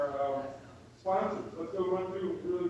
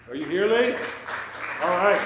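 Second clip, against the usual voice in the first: loud call across the room; 11 dB louder than usual. Real, not acted. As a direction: call out to find someone. Calling for the next speaker.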